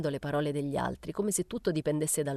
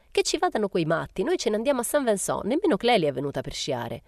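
loud, high-pitched brushing aside; emphatic dismissal; 5 semitones higher, 5 dB louder than usual. A narrator's reading. Momina dismissing the mother's worry about skis, emphatic. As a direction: overrule the objection with raised voice.

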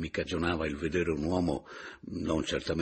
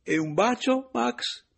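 high, loud, teasing voice; playful proposal; 7 dB louder, slower than usual. A narrator's reading. Paleari playfully asks the spirit for a kiss. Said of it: "propose allora il Paleari".

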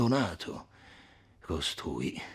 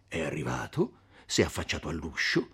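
usual speaking voice

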